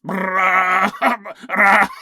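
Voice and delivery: rolled goat bleat like a T-Rex; botched, roaring imitation of a goat; rough goat bleat. Shown as a demonstration. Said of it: "Sounds like a T-Rex".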